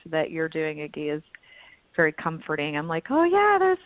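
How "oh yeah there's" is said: high, louder burst of recognition; light up on finding company; re-enacted happy discovery, pitched way up; delighted realization. Voicing her relief at finding peers.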